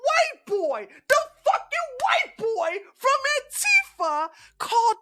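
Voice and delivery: outraged high-pitched yell; outraged disbelief. Real, not acted. Streamer's outraged high-pitched rant.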